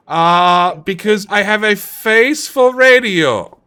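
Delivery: drawn-out, slow punchline; slow joke. Acted. Streamer slowly delivering the face for radio joke. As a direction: lean slowly into the self-mocking joke.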